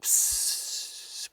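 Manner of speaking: faint unvoiced sleepy hiss; soft snake hiss.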